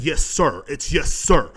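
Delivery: loud firm yes, sir, sir held long; stern correction. Retold or imitated. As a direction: stress on sir, loud and drawn out. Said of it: "I said".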